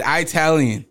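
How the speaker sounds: "Italian" with a long EYE first; imitated eye-talian. Host correcting girlfriend, imitated eye-talian "Italian". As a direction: mimic her "Italian" as eye-TAL-yun. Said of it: "You said Italian".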